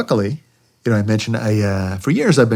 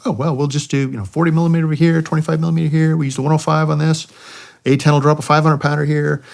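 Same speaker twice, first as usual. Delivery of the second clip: imitating the fire planners; 6 semitones higher than usual. Fire planners lay out the plan.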